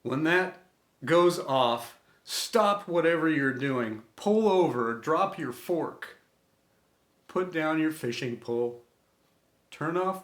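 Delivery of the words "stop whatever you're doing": plain reading voice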